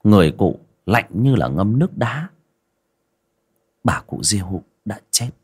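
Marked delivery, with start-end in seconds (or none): 3.8-5.3 hushed whisper of a grim reveal. A narrator's reading. Narrator revealing the death in a hushed whisper. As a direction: breathe it out like a secret.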